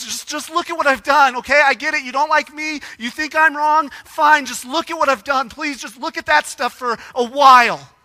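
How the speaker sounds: preacher voicing a desperate, pleading Jesus. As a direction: beg them to just look at the works. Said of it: "Jesus is kinda desperate".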